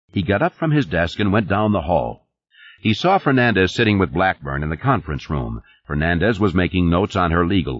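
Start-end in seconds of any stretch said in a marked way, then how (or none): none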